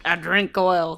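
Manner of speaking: Australian attempt gone Southern; badly faked Australian, sounding Southern; accidental Southern accent. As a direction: aim at Aussie, land in US South. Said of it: "that was very southern sounding".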